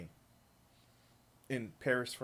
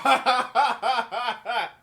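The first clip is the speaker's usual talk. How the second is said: loud shocked laugh; 6 semitones higher, 6 dB louder than usual. Real, not acted. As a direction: burst of disbelieving laughter. Laughing at the clip.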